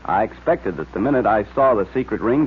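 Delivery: ordinary delivery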